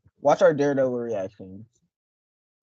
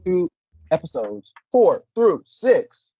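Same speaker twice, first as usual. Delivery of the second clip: slow, louder hype announce; announcer; 5 semitones higher, 5 dB louder, slower than usual. Acted. Announcing the episodes to viewers like a hype host.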